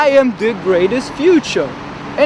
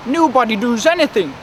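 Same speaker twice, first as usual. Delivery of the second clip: ordinary talk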